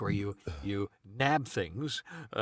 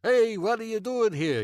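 high, louder put-on neighbor voice; 10 semitones higher, 4 dB louder than usual. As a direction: do the neighbor's voice, pitched up.

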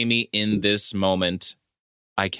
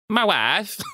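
put-on a light Borat-ish accent on "My wife"; mock Borat voice; 7 semitones higher than usual. Friend quoting the movie line, light Borat accent. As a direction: imitate Borat saying "My wife", playful. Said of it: "What is that movie?".

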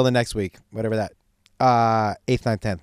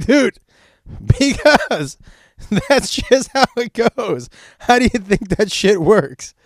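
laughing through it, higher and slower; can barely get it out for laughing; laughing explanation; 7 semitones higher, 5 dB louder, slower than usual. Retold or imitated. Dad laughing, explaining to son.

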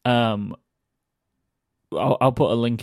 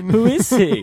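laughing through the question; breaking into laughter mid-question; 9 semitones higher, 3 dB louder than usual. Real, not acted. Laughing over a mystery character.

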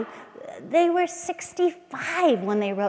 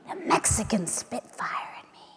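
whisper; 7 dB quieter than usual. Acted. Whispered line in poem.

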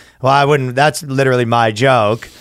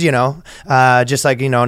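ordinary talk

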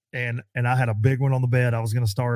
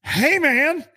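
1 mock protest; 13 semitones higher, 5 dB louder, much slower than usual. Confronting the local.

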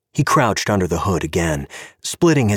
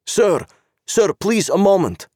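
put-on high, accented official voice; a stern Czech cop with broken English; Czech policeman; 12 semitones higher than usual. Czech cop stopping Gabe at the car, politely insistent.

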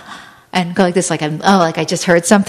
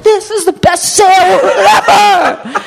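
much higher, louder, slow hyped sales yell, laughing; a laughing, hammed-up TV sale scream; jacked-up ad shout; 13 semitones higher, 5 dB louder, slower than usual. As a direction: yell it like a wired pitchman. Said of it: "like, on crystal meth, like, jacked up".

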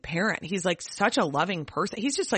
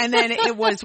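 laughing; 5 semitones higher, 5 dB louder than usual. Real, not acted. Still laughing at the story.

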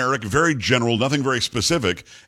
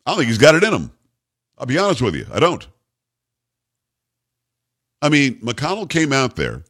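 ordinary talk, nothing marked

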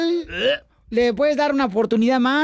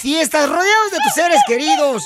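louder, higher upbeat holiday announcement; radio promo read; 4 semitones higher, 4 dB louder than usual. Acted. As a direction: sell the holiday greeting like a station promo.

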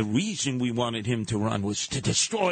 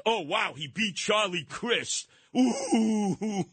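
higher, sarcastic gushing; sarcastic ooh; mock awe; 9 semitones higher, slower than usual. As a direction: fake being impressed. Mocking gushing admirers.

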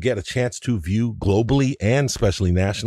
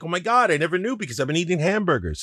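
excited; 9 semitones higher, faster than usual. Retold or imitated. Imagined delighted reaction to sushi.

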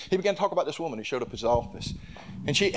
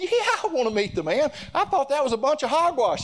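excited exclaim; 14 semitones higher, 4 dB louder than usual. Retold or imitated. Retelling his own outburst.

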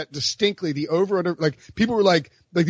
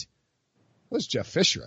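normal talking voice

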